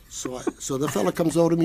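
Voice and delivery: usual speaking voice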